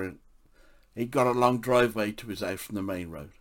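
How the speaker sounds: ordinary talk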